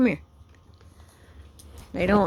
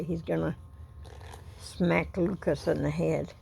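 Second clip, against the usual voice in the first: ordinary talk, nothing marked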